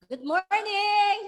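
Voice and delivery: big drawn-out good morning, high and loud; booming slow church greeting. Real, not acted. Pastor greets congregation loudly.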